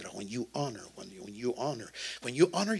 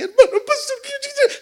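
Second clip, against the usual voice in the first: much higher, louder falsetto mimicry; squeaky drama queen; 13 dB louder than usual. Pastor mocks a dramatic congregant, in a shrill falsetto squeal. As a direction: squeal it like a hysterical churchgoer. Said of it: "no me venga, que".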